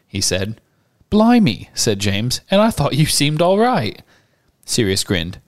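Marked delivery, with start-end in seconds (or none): 1.1-1.7 much higher, louder mock-shocked exclamation; surprised schoolboy. Boy reacting to his new friend, mock shock. 2.5-4.0 put-on higher, joshing boy voice; teasing schoolboy. Boy teasing his new friend, playful schoolboy voice.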